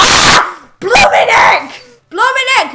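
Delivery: high, loud yelp of shock; startled shout. Real, not acted. Gamer hit by a sudden mob, shocked shouting. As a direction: blurt it out in a fright.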